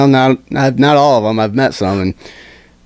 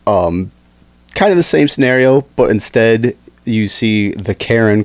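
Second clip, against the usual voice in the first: normal talking voice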